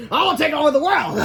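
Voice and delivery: high, loud laughing evil boast; villain boast. Acted. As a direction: gloat like a cartoon villain. Villain declares plan.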